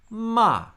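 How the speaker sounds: ma starts very high and drops sharply; fourth tone. Shown as a demonstration. Teacher gives ma the fourth tone, sharp fall. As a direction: snap ma down from the top. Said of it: "give it the four tones".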